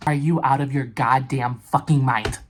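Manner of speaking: outraged at AAA; furious rant. Real, not acted. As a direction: let the anger out loud. AAA won't come.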